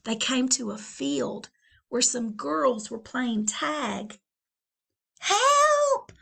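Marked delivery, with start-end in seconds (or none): 5.2-6.1 very high loud squeal; cry for help. Acted. Valentine to the girls, shrieking. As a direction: squeal for rescue. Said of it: "cried the Valentine".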